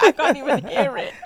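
laughing. Real, not acted. Laughing over own whispered figure.

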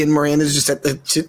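ordinary delivery

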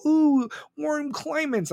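high, slow, mocking coo; mock the crowd's naive dream; mocking everyone. Mocking other preppers dreaming of the south, high slow coo. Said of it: "Everyone's gonna go".

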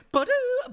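high sung-out piano riff; imitated piano sting. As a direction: mimic a little piano jingle with your voice. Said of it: "play that piano part".

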